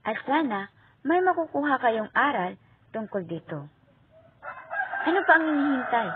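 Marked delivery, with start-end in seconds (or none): 5.1-6.1 excited call. Real, not acted. Masiglang paanyaya sa mga manonood.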